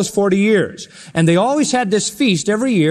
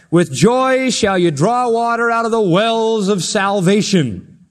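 slow, raised, drawn-out scripture recitation; reciting; 4 semitones higher, slower than usual. Retold or imitated. Worshippers reciting Isaiah behind the priest, slow raised recitation. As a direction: recite it grandly, stretching the words out. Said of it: "everybody would be reciting Isaiah twelve three".

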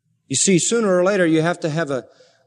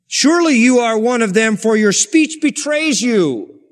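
accusing; 6 semitones higher, 6 dB louder than usual. Acted. Crowd accusing Peter in Bible story.

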